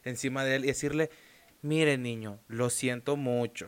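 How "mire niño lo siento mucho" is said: suave, bajo y lento, pidiendo perdón; súper suave. Pretend. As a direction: como un papá que se rebaja ante el hijo. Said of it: "Usted tiene que ser súper suave". (voz muy suave y lenta, disculpándose)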